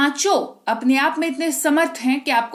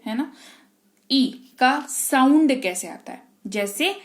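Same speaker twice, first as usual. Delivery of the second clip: ordinary talk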